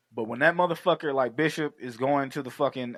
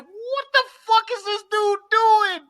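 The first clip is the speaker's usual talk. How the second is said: high, loud, drawn-out incredulous holler; 6 dB louder than usual. Retold or imitated. Retelling his shock at Bishop, hollering. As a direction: wail it slowly in disbelief, way up high. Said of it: "Dog, I was hollering".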